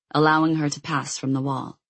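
ordinary delivery

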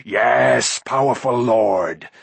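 slow, lightly voiced creature cry. A narrator's reading. Gurgi cries out to Gwydion, lightly voiced. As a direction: grovel out the words. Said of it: "Gurgi cried".

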